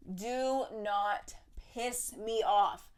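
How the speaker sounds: drawn-out, word-by-word warning; measured, slow-motion telling-off. Pretend. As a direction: space out every word, firmly. Acted slow warning to director.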